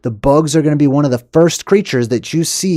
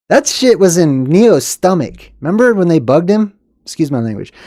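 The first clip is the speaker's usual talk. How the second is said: ordinary delivery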